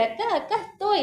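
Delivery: imitating a little girl desperate to be found; eager, high little-kid voice; frantic excited child. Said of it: "ella se vuelve loca porque quiere".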